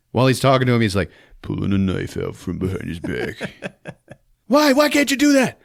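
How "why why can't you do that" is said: high, loud ranting thug voice; mock villain yell. Gunman yelling at hostages, high mock shout. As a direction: screech it like a crazed gunman.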